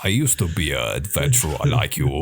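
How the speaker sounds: a hint of a Skyrim guard; Skyrim guard impression. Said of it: "impressions of characters from Skyrim".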